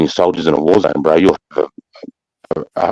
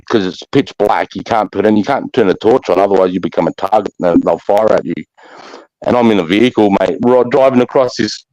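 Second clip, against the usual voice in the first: ordinary talk, nothing marked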